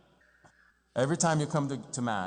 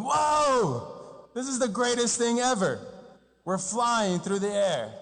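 high, wide-eyed gasp of wonder that stretches out slowly; imitating awe; 8 semitones higher, slower than usual. A passenger marveling at flight, mock awed and high. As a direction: gush like a kid on a first flight. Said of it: "be going".

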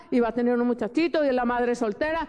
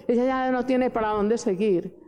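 usual speaking voice

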